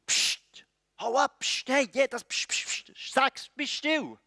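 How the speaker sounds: sharp, high-pitched hushing; irritated crowd silencing a shouter; shushing. Acted. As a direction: shoo someone away with hissed pssts.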